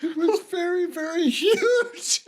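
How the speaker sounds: very high, squeezed laughing voice; helpless laughter. Real, not acted. Host repeats caller's innuendo, laughing helplessly. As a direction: struggle through the words while cracking up.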